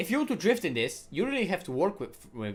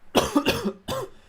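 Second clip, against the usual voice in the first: high cracking cough; 6 dB louder than usual. Real, not acted. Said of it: "That voice crack".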